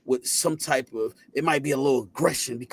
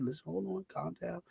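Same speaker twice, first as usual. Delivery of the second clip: hushed and gentle, much softer; calm her down softly, like a scared kid; dad quietly calming his panicked daughter; soothing hush; 3 semitones higher, 16 dB quieter than usual. Retold or imitated.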